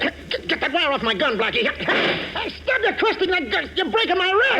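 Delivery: high, strained yelps of pain; pained cry. Acted. Inspector in pain as Blackie twists his gun.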